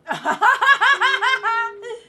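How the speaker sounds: loud, high peal of laughter; big laugh. Real, not acted. Viewer cracking up at the episode, big laugh. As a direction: burst out laughing, long and high.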